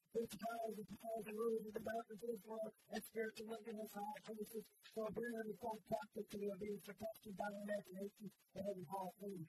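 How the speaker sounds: loud, high, fervent preacher's cry; full-force preaching. Real, not acted. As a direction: preach it out over the whole church.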